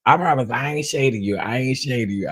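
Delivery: usual speaking voice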